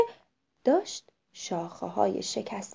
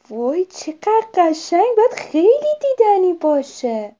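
wonderstruck; 8 semitones higher, 8 dB louder than usual. A narrator's reading. Owlet imagines fireworks the boy described, loud wonder.

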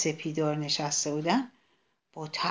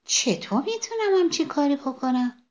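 surprised sunflower; 7 semitones higher than usual. A narrator's reading. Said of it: "با تعجب پرسید".

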